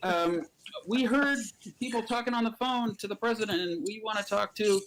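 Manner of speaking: high-pitched, hesitant request; eager newcomers. Acted. Guys run in asking to talk to the president, eager. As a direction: pipe up wanting a turn.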